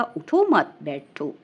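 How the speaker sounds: "اٹھو مت بیٹھو" said slowly with no break after "اٹھو"; run together, no pause. Shown as a demonstration. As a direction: join "اٹھو" straight onto "مت بیٹھو" so the meaning flips. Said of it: "اگر نہ ٹھہرا جائے تو ایسے بن جائے گا".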